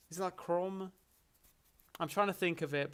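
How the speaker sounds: ordinary talk, nothing marked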